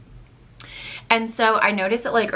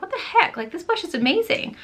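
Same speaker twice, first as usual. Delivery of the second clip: surprised; 11 semitones higher than usual. Retold or imitated. Retold shocked reaction to blush.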